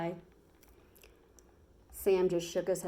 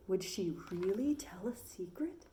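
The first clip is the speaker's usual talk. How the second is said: hushed narrator's whisper, softer; 6 dB quieter than usual. A narrator's reading. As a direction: breathe it like the hushed crowd. Hushed crowd wonders.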